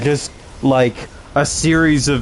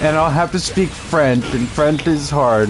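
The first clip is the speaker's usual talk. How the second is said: higher, lightly whiny complaint; mock complaining girlfriend; 4 semitones higher than usual. Girlfriend dreading speaking French, in a lightly higher whine. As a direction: sulk about speaking French. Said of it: "and she's like".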